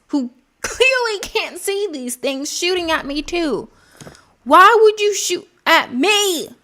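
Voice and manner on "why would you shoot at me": high, indignant protest; exasperated complaint. Real, not acted. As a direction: raise the pitch in exasperated disbelief. Complains of teammates shooting him.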